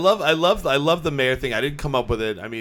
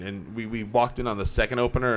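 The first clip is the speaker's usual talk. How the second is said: usual speaking voice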